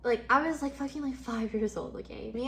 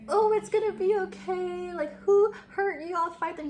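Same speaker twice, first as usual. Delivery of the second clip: put-on higher, louder cooing to an upset child; mock comforting; 8 semitones higher, 4 dB louder than usual. Imagined dad soothing his crying daughter, hammed-up comforting. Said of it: "he wasn't even like".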